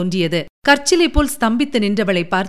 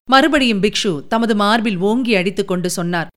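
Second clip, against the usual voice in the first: usual speaking voice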